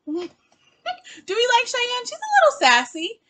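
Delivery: sing-song tease about the sassy one; teasing squeal. Pretend. Host teasing viewers about the sassy sister, squealy.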